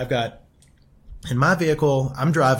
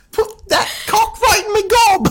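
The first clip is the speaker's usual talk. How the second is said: much higher, louder hammed-up cockney voice; cockney impression; 7 dB louder than usual. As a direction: ham up a squeaky cockney lady offering it. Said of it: "Let me see if I can do the, the voice".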